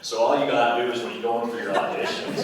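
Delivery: loud, put-on American accent. Shown as a demonstration. Actor describing audition courses, in an American accent. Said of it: "to learn the American accent".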